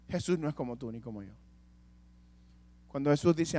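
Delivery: normal talking voice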